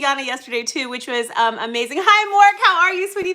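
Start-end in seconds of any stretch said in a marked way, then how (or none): 2.0-3.3 excited greeting. Real, not acted. Greeting viewer.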